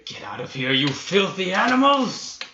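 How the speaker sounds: rising to a high, loud roar; a warrior yelling at wolves; shouting at the wolves. Acted. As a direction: drive the beasts off with a bellow. DM voices rescuer shouting at wolves.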